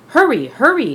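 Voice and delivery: high, loud urgent call; rally the crew to hurry. Acted. Fire chief calling his crew to the truck, urgent and loud. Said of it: "called the fire chief".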